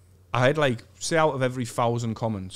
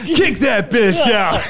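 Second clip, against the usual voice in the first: put-on a higher, louder, slow American accent, cracking up; louder fake American; 10 semitones higher, 11 dB louder, much slower than usual. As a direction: bellow it in a laughing mock-American voice. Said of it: "Why did you turn American?".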